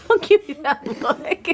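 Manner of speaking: laughing through the words. Real, not acted. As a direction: giggle while you say it. Mom laughing at a look.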